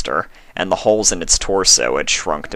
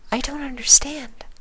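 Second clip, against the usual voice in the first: high, breathy whisper of a timid pony; gentle, shy, barely daring to ask; Fluttershy's voice. A narrator's reading. Fluttershy confused.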